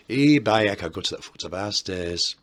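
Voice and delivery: hammed-up northern accent with a loud opening; northern exclamation, broad accent, loud at the start; northern English accent. Shown as a demonstration. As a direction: burst out in a put-on northern English voice. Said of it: "That was my northern accent".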